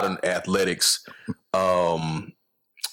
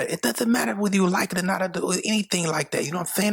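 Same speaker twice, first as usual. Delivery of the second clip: mock voice; 7 semitones higher, faster than usual. Mock voice replying.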